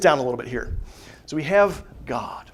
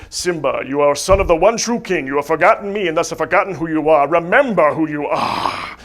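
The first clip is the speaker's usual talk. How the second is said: louder, booming royal command; a mild ghostly king from the clouds; hammed-up father echoing from the sky; Mufasa's voice; 6 dB louder than usual. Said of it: "his father Mufasa there, he says".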